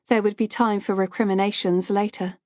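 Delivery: ordinary delivery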